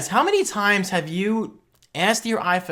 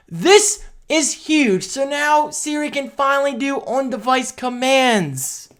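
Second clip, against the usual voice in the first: still fired up; 9 semitones higher, slower than usual. Real, not acted. Still riled up after the rant.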